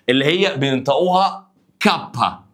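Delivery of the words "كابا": "كابا" as Italian "kappa"; "كابا" set out slowly as a letter name; pronunciation demo. Shown as a demonstration. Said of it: "بتنطق كده".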